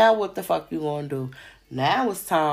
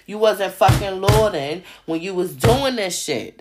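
ordinary talk, nothing marked

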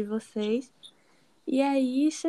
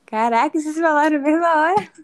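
louder, higher, fast exclamation; amazed; 5 semitones higher, 10 dB louder, faster than usual. Real, not acted. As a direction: burst out in surprise, louder. Both answered at once.

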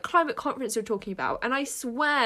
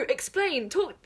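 ordinary talk

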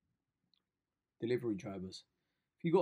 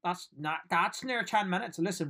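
normal talking voice

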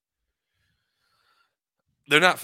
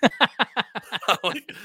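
talking through a high laugh; 13 semitones higher, 4 dB louder than usual. Real, not acted. Dad recounting the girls' jokes, laughing. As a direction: laugh while getting the words out.

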